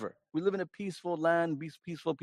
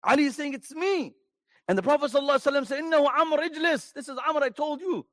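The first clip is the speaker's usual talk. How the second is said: excited retelling; 8 semitones higher, faster than usual. Acted. Retells Ali and Prophet exchange.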